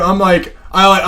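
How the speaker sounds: ordinary delivery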